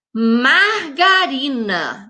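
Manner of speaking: "Margarina" drawn out slowly, syllable by syllable; syllable-stretched. Shown as a demonstration. Teacher showing pupils the four syllables, "Margarina" drawn out. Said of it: "Margarina tem quatro sílabas".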